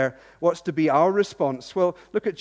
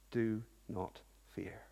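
very soft, low and slow; tender, lowered voice, unhurried; gentle reassurance; 7 semitones lower, 13 dB quieter, slower than usual. Acted. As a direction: hush it down to calm a troubled heart. God's reassurance, quoted in prayer.

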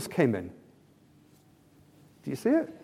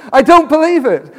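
loud, higher-pitched incredulous catchphrase; Victor Meldrew; 7 semitones higher, 6 dB louder than usual. Acted. Preacher retrying a TV catchphrase for his congregation, exasperated disbelief. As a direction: blurt it out in exasperated mock disbelief. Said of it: "I can't do his, his accent".

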